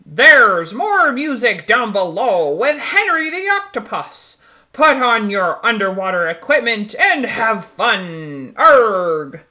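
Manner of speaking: loud, hearty pirate voice booming across the ship; pirate captain. Acted. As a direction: play a jolly pirate calling from his deck. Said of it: "Captain Feathersword says".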